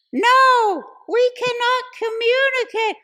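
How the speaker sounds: very high, slow, loud mock refusal; arguing girlfriend. Girlfriend refusing her boyfriend upstairs, high mock wail. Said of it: "And she's like".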